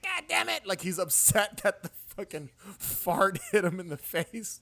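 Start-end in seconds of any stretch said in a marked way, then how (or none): 0.0-0.7 angry outburst. Retold or imitated. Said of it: "he's like".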